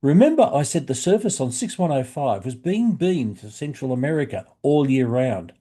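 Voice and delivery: usual speaking voice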